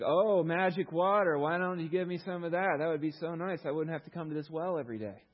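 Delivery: drip sarcasm, scoffing at the offer. Retold or imitated. Woman at the well mocking Jesus, loud and sarcastic. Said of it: "a little sarcasm here in her voice".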